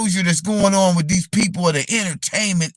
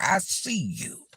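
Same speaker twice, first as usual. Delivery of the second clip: normal talking voice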